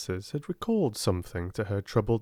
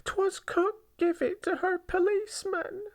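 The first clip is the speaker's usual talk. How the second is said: a lightly voiced young maid, high; high girlish voice pleading innocence; young servant girl protesting, lightly voiced; girl's voice. A narrator's reading. Quoted girl's protest in story.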